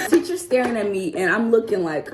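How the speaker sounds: ordinary delivery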